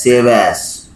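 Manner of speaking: ordinary talk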